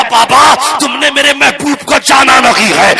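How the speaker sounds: put-on a dying boy crying out in anguish to his father; anguished outcry. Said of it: "بچہ تڑپ جاتا ہے".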